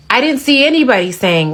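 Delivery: ordinary talk, nothing marked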